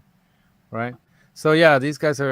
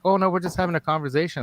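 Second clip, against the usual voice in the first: lightly voiced family; 7 semitones higher, faster than usual. Family voiced, casual reply.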